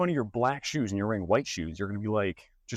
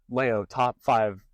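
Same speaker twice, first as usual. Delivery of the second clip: ordinary talk, nothing marked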